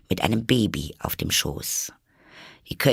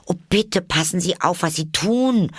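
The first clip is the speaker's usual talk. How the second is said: high, louder alarmed plea; frightened cry; 10 semitones higher, 4 dB louder than usual. A narrator's reading. Alice crying out as pans fly at the baby. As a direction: cry out in fear. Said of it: "rief Alice, die in großer Angst".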